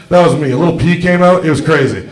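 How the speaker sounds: normal talking voice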